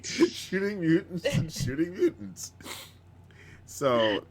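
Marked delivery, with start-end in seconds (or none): 0.0-2.5 laughing. Real, not acted. Echoing friend, laughing.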